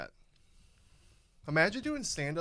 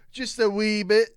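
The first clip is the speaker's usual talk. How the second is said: loud Scottish brogue; broad Scottish voice; Scottish accent; 6 semitones higher, 8 dB louder than usual. Pretend. Repeated louder, Scottish-ish voice.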